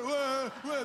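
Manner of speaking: high, loud whooping; mock football cheer. Preacher mimicking his friend cheering at the match, wild.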